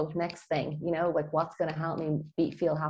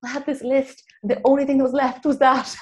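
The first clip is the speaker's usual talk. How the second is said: excited; 9 semitones higher, 9 dB louder than usual. Retold or imitated. Retold own reply, excited.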